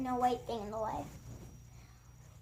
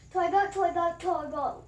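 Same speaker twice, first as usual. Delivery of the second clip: loud chant easing back down; yelled tongue twister; 4 semitones higher, 6 dB louder than usual. Shown as a demonstration. Vlogger finishing the "three times fast" challenge, yelled tongue twister. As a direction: start high and loud, then settle. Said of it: "I'm yelling toy boat".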